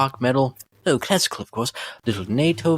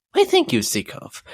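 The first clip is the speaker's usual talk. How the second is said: flattered coo. Acted. Flattered by compliment.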